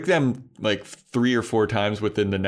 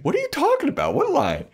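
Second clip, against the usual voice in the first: very high incredulous squawk; a cartoon shriek of confusion; mock baffled Spike; faster than usual. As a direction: throw it up high in disbelief.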